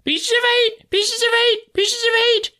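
very high, loud squawking parrot; shrill parrot voice. A narrator's reading. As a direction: screech it like a parrot. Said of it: "a shrill voice broke forth out of the darkness".

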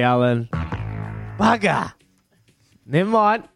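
normal talking voice

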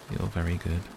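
plain reading voice

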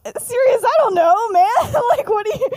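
high, loud, flustered protest breaking into laughter; hammed-up panicky squeal, laughing through it; mock panic. Remus pushed by Sirius about girlfriends, in mock panic. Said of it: "Remus straight up panics".

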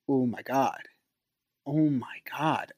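soft, hushed awe; stunned realization. Retold or imitated. Reading about narcissistic disorder, hushed stunned realization. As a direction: breathe it out quietly as it sinks in.

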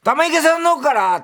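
high, loud mock call to a taxi driver; silly over-the-top call-out. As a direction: belt out the destination like a fool. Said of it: "バカみたいな言い方しなくていい". (バカみたいに甲高く叫ぶ言い方)

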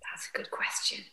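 thoughtful whisper. Real, not acted. Guest ponders the life raft question, whispering. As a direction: hush it as if mulling it over.

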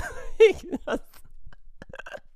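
breathless high laugh. Real, not acted. Laughing at his own retold comeback, breathless real laughter. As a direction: break into real helpless laughter.

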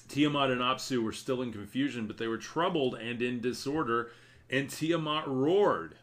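dramatic reading. Acted. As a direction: read the verses with dramatic force. Rereading the passage with force.

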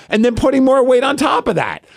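shouting it in disbelief; retold outburst. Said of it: "I'm like".

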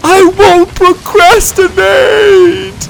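slow, high falling scream. A narrator's reading. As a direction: scream it as you fall. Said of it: "he cried as he fell".

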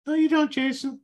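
high, loud, mocking little inner voice; subconscious voice. The subconscious scoffing at Jason's affirmation, high and mocking. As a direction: squeak it out like a doubting gremlin in your head. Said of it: "my subconscious voice".

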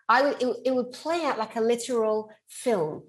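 ordinary talk

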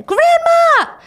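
high, loud cry of "Grandma!"; calling out. Retold or imitated. Yeti bursting in on grandma, high shout. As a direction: shriek it out in alarm.

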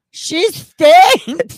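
put-on high, loud little-kid voice; three-year-old's voice. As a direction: blurt it out like an outraged toddler. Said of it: "Out of a three-year-old voice".

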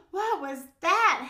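slow, high, loud wail; alarmed howl. A narrator's reading. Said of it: "howled Max".

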